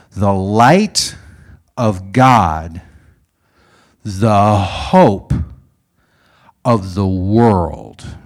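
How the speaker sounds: loud, stretched phrases with long pauses; ringing ceremonial declaration; solemn proclamation. Retold or imitated. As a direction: proclaim it slowly to a crowd. Old man proclaims over the candlelit crowd.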